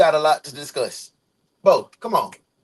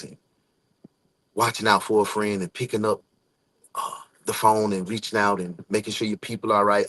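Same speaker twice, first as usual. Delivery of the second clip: heartfelt encouragement; 6 semitones lower than usual. Real, not acted. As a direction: speak tenderly, letting each praise settle. Heartfelt encouragement shared with someone.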